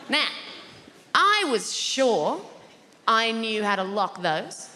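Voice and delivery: ordinary talk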